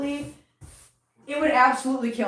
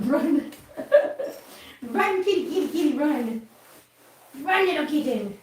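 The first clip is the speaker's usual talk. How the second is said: playful urging; 3 semitones higher than usual. Pretend. Playing chase with kitten.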